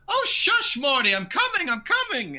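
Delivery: high-pitched merchant calling from outside; Nico shouting. Acted. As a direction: holler at the dog in a high, loud character voice. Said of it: "you hear a shouting".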